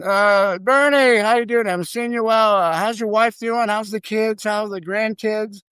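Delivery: high-pitched hearty patter of pleasantries; glad-handing greeting. Retold or imitated. Big shot greets the shift boss, glad-handing.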